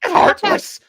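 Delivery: high, loud, strained battle cry; Goku. Acted. Hero transforming into Super Saiyan, a high, loud furious cry. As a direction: build to a furious anime-hero yell. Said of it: "it's Goku's transformation into Super Saiyan".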